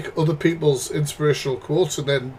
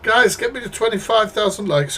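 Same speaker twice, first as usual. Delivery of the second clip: higher mock hype-streamer voice, fast at first; mild send-up of a likes-hungry creator; 6 semitones higher than usual. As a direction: parody a begging streamer chasing likes. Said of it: "I should be saying".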